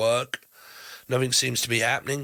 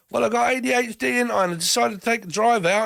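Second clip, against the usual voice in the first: cheeky geezer; 9 semitones higher than usual. Pretend. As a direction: shrug it off like a lad. Voicing the flaky partner.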